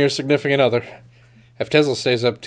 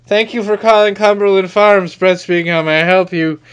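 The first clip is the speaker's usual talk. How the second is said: a touch of a cheerful shop-clerk phone voice, slightly higher and louder; sleep-talking phone greeting; 5 semitones higher, 4 dB louder than usual. Retold or imitated. Retelling how he answered the store phone in his sleep, mock clerk voice. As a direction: lightly play the chirpy store greeting on autopilot. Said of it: "hear myself go".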